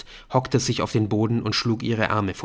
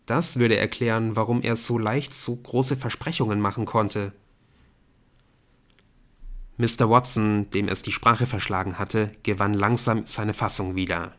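ordinary delivery

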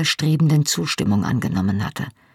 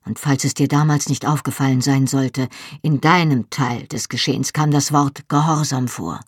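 usual speaking voice

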